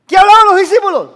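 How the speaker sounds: high, fast, very loud demand; urgent shouted question. Real, not acted. Preacher presses the congregation to answer, urgent shout. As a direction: fire the question at the crowd.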